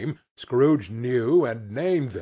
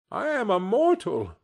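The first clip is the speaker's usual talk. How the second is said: higher, pleading protest; remonstrating; 8 semitones higher than usual. A narrator's reading. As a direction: object nervously to the spirit. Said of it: "Scrooge remonstrated".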